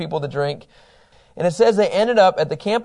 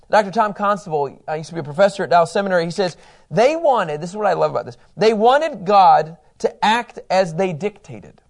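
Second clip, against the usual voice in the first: usual speaking voice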